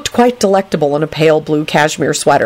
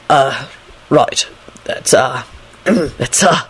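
low, halting hesitation; lost for words, throat-clearing; awkward stalling; 4 semitones lower, much slower than usual. A narrator's reading. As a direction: stall awkwardly with long gaps. Quentin stalls for a compliment.

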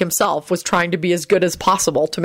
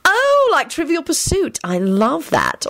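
high, lingering happy recognition; beaming delight; 11 semitones higher, slower than usual. A narrator's reading. Elizabeth recognises the game Lupin describes, beaming. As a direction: draw it out with delighted pleasure. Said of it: "Elizabeth beamed".

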